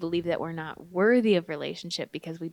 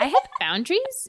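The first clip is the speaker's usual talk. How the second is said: playful fake toughness; higher, louder mock-firm delivery; mock stern; 7 semitones higher, 4 dB louder than usual. Mock-stern repeat of the joke.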